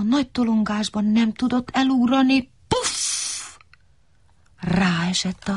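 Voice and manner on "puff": thud sound. A narrator's reading. Tree falls on him.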